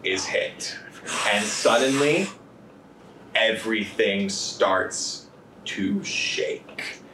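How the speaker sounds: slow, hushed suspense narration; dramatic narration. Acted. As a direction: draw out each beat for tension. GM narrates tense scene.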